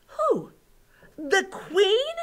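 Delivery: high-pitched shocked question; scandalised high yelp; aghast. A narrator's reading. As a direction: cry out in disbelief.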